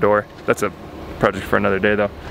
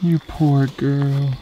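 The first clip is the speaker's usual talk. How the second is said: whispered comfort; much slower than usual. Real, not acted. Comforting hurt cow.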